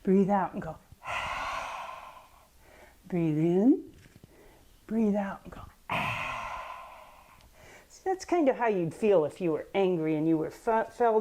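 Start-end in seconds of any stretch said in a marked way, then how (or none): 1.0-2.0 long hissing angry exhale; voiceless snake hiss of anger; angry breath. Shown as a demonstration. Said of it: "make a breath like you were angry". 5.9-6.7 long hissing angry exhale; voiceless snake hiss of anger; angry breath. Shown as a demonstration. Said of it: "make that hissing sound".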